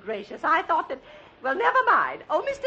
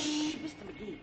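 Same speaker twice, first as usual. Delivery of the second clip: much softer, hushed "Shh"; quiet him down before people stare; hushing; 11 dB quieter than usual. Acted.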